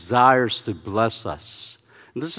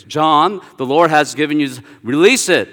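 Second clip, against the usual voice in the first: louder, insistent, impatient command; a little irritated; 3 semitones higher, 7 dB louder than usual. Retold or imitated. Minister urging stuck student to release tongues, irritated. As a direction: push them impatiently, voice raised. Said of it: "he gets a little irritated".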